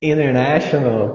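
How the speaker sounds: International said slowly as "innernational", no t; American international. Shown as a demonstration. Teacher showing the student the American International. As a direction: drop the t in International. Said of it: "já ficou American English".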